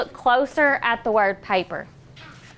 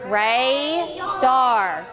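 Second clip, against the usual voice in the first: slowly sounding out ra-dar syllable by syllable; a teacher modelling radar slowly; stretched out. Shown as a demonstration. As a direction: drag out radar so each syllable is heard. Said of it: "This is".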